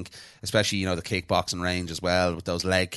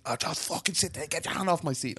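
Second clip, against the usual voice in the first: higher, softer mock snap; retold anger; 9 semitones higher, 6 dB quieter than usual. Telling the seat-grabber off, retold higher mock snap. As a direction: hiss it in mock fury. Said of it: "I was like".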